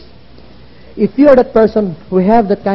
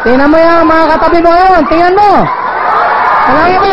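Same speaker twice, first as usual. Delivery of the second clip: rally the crowd with a pitched-up shout; excited urging; 9 semitones higher than usual. Real, not acted. Speaker urging audience to look at seatmates, excited urging.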